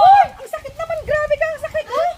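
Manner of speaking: pained shouting. Real, not acted. Victim cries out in pain.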